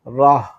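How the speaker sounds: റാഹ് loud, long ah with breath-out h; pronunciation demo. Shown as a demonstration. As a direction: say റാഹ് as one firm, separate syllable. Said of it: "പ്രൊനൗൺസിയേഷനാണ്". (റാഹ് ഉറക്കെ, വേറിട്ട അക്ഷരമായി)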